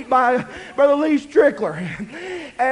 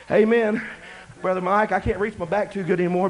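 normal talking voice

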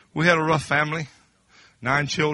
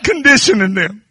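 laughing; 6 semitones higher, 7 dB louder than usual. Real, not acted. Laughing into the climax.

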